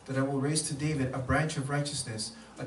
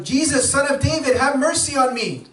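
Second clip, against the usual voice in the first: very high, loud scream for mercy; 11 semitones higher, 11 dB louder than usual. Acted. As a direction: blind man screaming to Jesus. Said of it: "scream out even louder".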